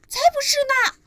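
four siblings shouting together; shouted protest. Acted. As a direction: yell back in outrage. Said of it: "大喊道".

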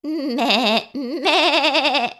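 high, rapidly wavering goat call; goat bleat. A narrator's reading. Goat calls to spider with high wavering bleats. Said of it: "said the goat".

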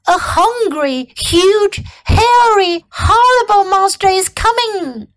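high-pitched mock alarm; yelping fox. A narrator's reading. Fox warns of a hungry monster, yelping. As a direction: stretch each scary word in alarm. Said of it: "yelped Fox".

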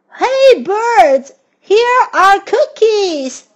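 high, loud call to the birds; calling the birds to come get cookies, shouting. Acted. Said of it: "He shouted in a loud voice".

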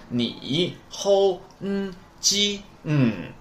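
你吼嗯鸡嗯 slow, each sound held apart; drawn-out syllables. Shown as a demonstration. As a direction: stretch 你吼嗯鸡嗯, high middle and falling last sound. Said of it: "所以连上就是". (你吼嗯鸡嗯逐音拖长，中间平高，最后降调)

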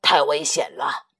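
loud, scolding father; angry shout. Acted. T-rex scolding his son for wandering off, loud and angry. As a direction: bark out the worry as anger. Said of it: "霸王龙生气地大叫着".

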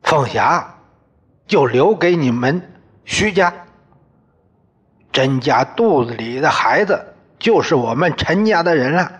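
father-in-law shouting terms to the son-in-law. Acted. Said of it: "喊道".

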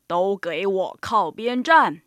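low, slow, loud order; slow, weighty elephant command; bossy elephant. Acted. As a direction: push them aside with a heavy, deep voice. Elephant orders rivals aside.

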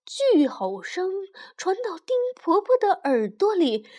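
plain reading voice

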